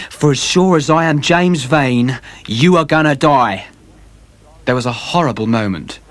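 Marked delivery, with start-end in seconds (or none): 0.1-3.7 vengeful sailor. Acted. James Vane threatens.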